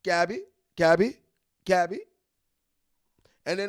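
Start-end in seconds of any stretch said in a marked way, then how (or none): 0.0-2.0 loud street call of Cabbie, each one rising; hollering Cabbie, Cabbie, Cabbie at passing drivers. Retold or imitated. Guys on the street calling to passersby, loud rising calls. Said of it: "you get guys standing out saying".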